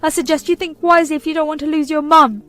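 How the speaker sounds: high, loud guilt-tripping mother; shrill ultimatum. Acted. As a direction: demand he choose her.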